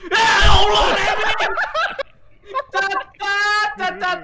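yelling Remi's name, laughing; shocked shout. Real, not acted. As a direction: cry out in shock. Run over by teammate. (teriak kaget)

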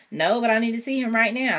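firm and pushing. Acted. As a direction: stand your ground. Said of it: "I said firmly".

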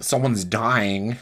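usual speaking voice

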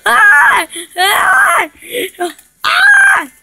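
piercingly high, loud screams; terrified play screams. Pretend. Player attacked by the giant baby, high screams. Giant monster appears in game.

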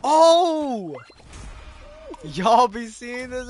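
loud, high excited yell; hype shout. Real, not acted. Streamer hyping a clutch play to chat, loud cheer.

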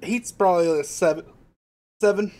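hiccups breaking the words; hiccuping while eating. Real, not acted. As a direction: speak between hiccups. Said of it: "I naturally have hiccups with hot food".

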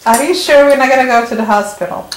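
ordinary talk

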